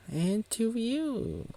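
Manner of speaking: very high, slow put-on greeting; acted polite reply. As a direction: return the greeting in a high, drawn-out voice.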